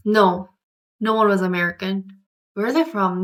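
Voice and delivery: ordinary delivery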